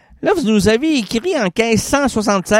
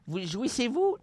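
ghost voice; 5 semitones higher, 12 dB quieter than usual. Acted. Spirit asks the question.

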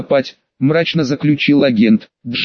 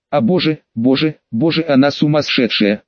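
ordinary delivery, nothing marked

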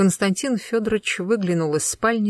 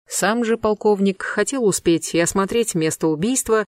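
ordinary delivery

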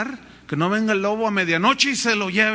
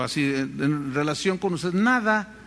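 ordinary talk

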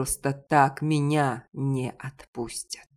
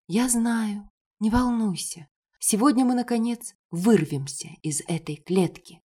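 put-on girl's gentle voice; 7 semitones higher than usual. A narrator's reading. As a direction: reassure him gently, voice lifted. Elaine comforts hero. (нежный девичий голос, выше обычного)

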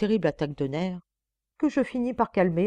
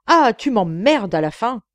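loud, higher, swooping fed-up cry; coarse fishwife outburst; 4 semitones higher, 8 dB louder than usual. A narrator's reading. Wife cursing her husband, coarse angry outburst. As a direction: spit it out like a washhouse shrew. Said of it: "vomit madame d'une voix de lavoir".

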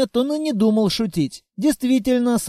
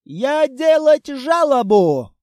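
higher, louder broken-Russian wail; wailing pompous foreigner; 4 semitones higher, 4 dB louder, slower than usual. A narrator's reading. Толстяк улетает в портал и вопит, громко и высоко. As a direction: howl the complaint as he flies back. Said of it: "завопил заокраинец".

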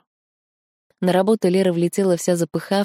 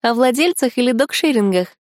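put-on much higher, chirpy girlfriend voice; bright friend voice; 7 semitones higher, 3 dB louder than usual. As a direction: pop in curious and high. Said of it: "голос Тани".

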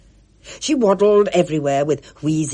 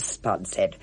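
ordinary delivery, nothing marked

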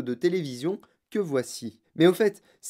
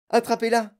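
loud, high, eager order; high-pitched thrilled command; excited shout; 7 semitones higher, 5 dB louder than usual. A narrator's reading. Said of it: "cria monsieur Wonka, de plus en plus excité".

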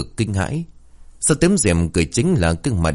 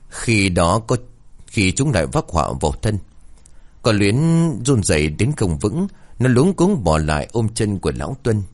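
plain reading voice

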